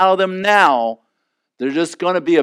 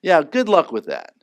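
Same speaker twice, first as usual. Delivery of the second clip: normal talking voice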